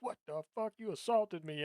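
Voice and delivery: mock offended cat. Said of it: "She was like".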